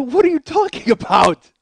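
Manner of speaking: loud, high indignant yell; mock outraged shout; outraged protest. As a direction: explode in acted-out disbelief. Retells outraged reply to wife's accusation.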